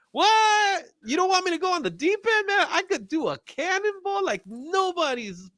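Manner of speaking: high, quick playful indignation; mock offended. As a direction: pretend to be insulted, high. Mock offended reply to chat.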